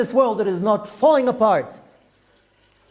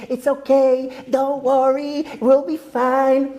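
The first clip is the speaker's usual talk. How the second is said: mock soothing rabbi; 3 semitones higher, slower than usual. Rabbi mocking rabbis who say don't worry, syrupy parody. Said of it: "One of these rabbis who's saying".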